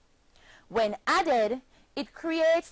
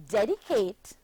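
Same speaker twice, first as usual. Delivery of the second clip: ordinary talk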